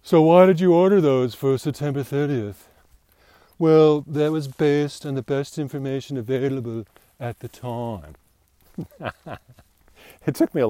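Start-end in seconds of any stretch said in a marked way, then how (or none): 0.0-2.7 high, pointed question from the boss; mock interrogator asking why; challenging boss. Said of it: "my favorite English excuse". 3.6-8.1 put-on high, smooth English-accented excuse; polished English deflection. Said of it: "my favorite English excuse".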